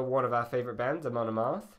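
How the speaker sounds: normal talking voice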